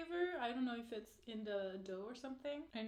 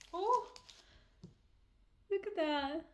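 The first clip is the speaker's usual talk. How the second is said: surprised; 6 semitones higher, 4 dB louder than usual. Real, not acted. Sees the little cookie.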